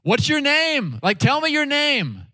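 high-pitched, pressing question; insistent asking. Acted. Moses pressing God for his name, insistent. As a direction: raise the pitch and demand an answer.

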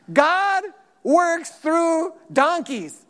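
very slow, drawn-out punchline; wry, slow line in a sermon; slow emphatic. Real, not acted. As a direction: lean on each word slowly. Joking emphatic aside.

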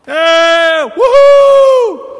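whooping at the top of the voice; joyful shout. Shown as a demonstration. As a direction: let out a big worship cheer. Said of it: "I shouted because I couldn't not".

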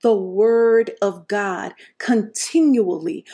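drawn-out emphatic restatement; slow solemn repeat. Shown as a demonstration. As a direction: lay it down again slowly. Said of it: "I'll say that again".

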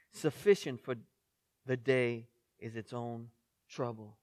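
soft, measured Bible reading; hushed, unhurried reading of scripture. Acted. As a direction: read the passage gently and reverently. Reads scripture passage aloud.

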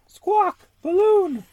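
very high, loud parrot call; parrot squawk. Acted. Polly the parrot calls after the balloon, high and loud. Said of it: "says Polly Parrot".

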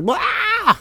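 high-pitched mock yelp of panic; imitated holler. Dad imitating his boy suddenly hollering in the pool, high mock yelp. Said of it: "he starts hooting and hollering".

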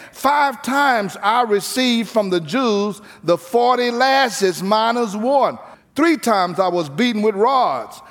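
high, ringing pulpit preaching; preaching at full force. Acted. Preacher to congregation, voicing Paul in full preaching force. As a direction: proclaim Paul's sufferings to the church.

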